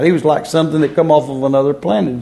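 usual speaking voice

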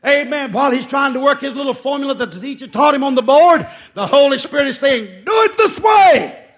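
pulpit build into a high, loud shout; climactic preaching. Acted.

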